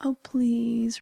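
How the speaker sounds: mock-beg with a stretched please; wheedling, half in jest; playful begging. Playfully begs after saying she will.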